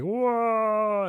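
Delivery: very high, strange mock cry of shock; imitating Vecna. Vecna reacting to Eleven's attack, in a high mock yelp. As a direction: yelp it like the villain thrown back. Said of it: "he was like".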